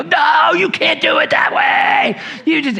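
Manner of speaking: high, loud exasperated protest; worked up. Retold or imitated. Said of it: "he would get so worked up".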